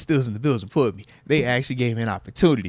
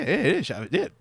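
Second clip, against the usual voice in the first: normal talking voice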